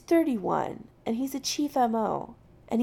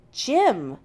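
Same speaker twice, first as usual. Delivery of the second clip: loud, higher-pitched insistence; just as firm; 4 semitones higher, 8 dB louder than usual. A narrator's reading. Jim correcting McCoy's use of his title, firmly. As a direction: firmly insist on the first name. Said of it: "Jim repeats just as firmly".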